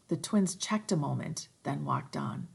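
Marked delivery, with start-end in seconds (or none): none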